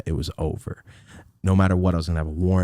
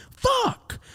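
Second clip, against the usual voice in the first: high panicked curse. Retold or imitated.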